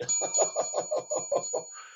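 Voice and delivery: very high, gleeful cackle; high-pitched laugh. Real, not acted. Streamer delights in the rising price, high gleeful laugh. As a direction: burst into a squealing laugh.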